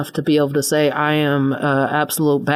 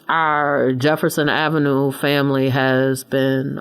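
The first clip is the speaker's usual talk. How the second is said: ordinary delivery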